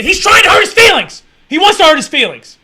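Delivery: high, loud exasperated shouting; high, loud outburst of disbelief; loud rant. Real, not acted. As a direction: yell it in disbelief. Ranting at host's defense.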